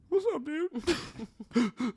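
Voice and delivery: very high, drawn-out choked-up greeting; mock tearful. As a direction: imitate answering the phone upset, high and stretched. Answers friend's call while very upset.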